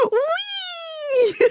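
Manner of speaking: very high, long squealed whee; gleeful whee. Acted. Third girl forgetting the rules on the slide, high gleeful squeal. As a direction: squeal it like sliding down, about to laugh. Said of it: "she goes".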